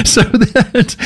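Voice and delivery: words squeezed out through laughter; can't stop laughing mid-sentence; breaking up with laughter, drawn out. Real, not acted.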